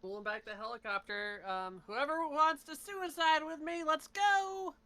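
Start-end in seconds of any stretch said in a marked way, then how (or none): 1.9-4.7 high, loud, drawn-out holler; rallying shout. Acted. Player rallying others onto the helicopter, rallying shout. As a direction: build to a loud, high "let's go".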